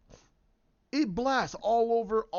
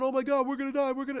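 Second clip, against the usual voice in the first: higher, hurried cries of alarm; mock panic; 6 semitones higher, much faster than usual. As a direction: imitate terrified passengers without really screaming. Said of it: "screaming like they're gonna die".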